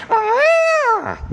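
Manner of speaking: high, loud elephant roar cry; a wild elephant's furious bellow; elephant trumpet. Retold or imitated. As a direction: trumpet like an angry trapped elephant. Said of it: "throws his trunk up in the air and goes".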